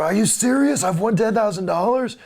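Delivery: imitating a high, loud, incredulous caller; freaked-out prize winner. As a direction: squeal it like a thrilled contest winner. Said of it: "we were freaking people out".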